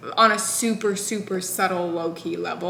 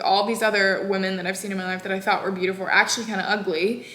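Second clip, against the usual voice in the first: ordinary talk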